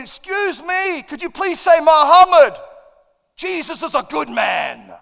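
high, very loud, drawn-out call; indignant shout, stretched out slowly; re-enacted public rebuke. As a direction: holler it across the field. Retells calling out to rebuke the man.

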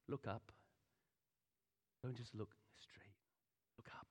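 breathy hushed urging; whisper. Real, not acted. Preacher urging the congregation to look up, whispered. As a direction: lean in and whisper the call. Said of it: "preacher drops to whisper".

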